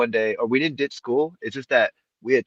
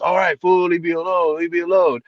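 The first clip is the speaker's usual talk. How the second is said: higher, whiny brush-off; imitated protest; 5 semitones higher than usual. Tim brushing off the campus officer, imitated protest. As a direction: mimic a kid fed up with being hassled.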